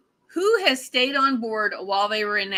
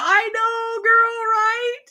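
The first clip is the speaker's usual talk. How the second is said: high, squealing agreement; excited agreement; 12 semitones higher, 3 dB louder, slower than usual. Real, not acted. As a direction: squeal it in shared envy. Enthusiastic agreement with viewer's comment.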